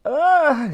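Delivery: high hit cry; sharp high yelp of pain; pained grunt. A narrator's reading. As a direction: cry out as if shot in the stomach. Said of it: "screamed Titch".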